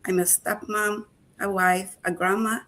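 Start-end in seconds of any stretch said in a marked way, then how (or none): none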